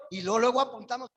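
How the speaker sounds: normal talking voice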